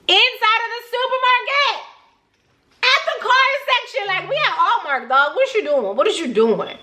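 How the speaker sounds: shocked it happened in a store; incredulous. Real, not acted.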